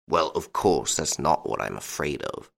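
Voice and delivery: lightly voiced old Duke, low and subdued; worried old Duke. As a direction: brush the reassurance aside in a low voice.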